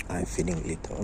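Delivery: low, soft echo to yourself; quiet repeat. Shown as a demonstration. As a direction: let "I'm feeling little" sink to a near-whisper. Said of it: "just like I'm telling you".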